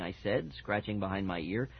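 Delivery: usual speaking voice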